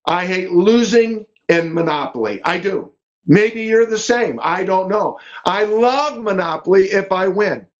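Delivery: ordinary talk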